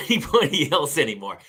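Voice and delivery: ordinary talk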